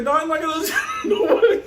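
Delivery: high, rapid refusal breaking into laughter; laughing mock refusal. Trapped friend refuses to open for Mike, high laughing mock panic. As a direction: rush out a stubborn no, cracking up.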